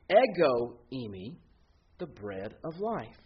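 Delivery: "Ego eimi" said EH-go EE-mee, slowly, rising on ego; Greek compound. Shown as a demonstration. Preacher quoting Jesus in Greek, slow EH-go EE-mee. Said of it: "makes this compound word".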